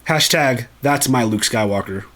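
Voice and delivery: usual speaking voice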